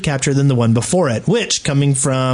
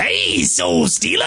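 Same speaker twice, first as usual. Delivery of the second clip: high, drawn-out Cockney shout; hammed-up Cockney holler; mock Cockney; 11 semitones higher, slower than usual. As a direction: yell it as a cartoon Cockney. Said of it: "I think it's Cockney".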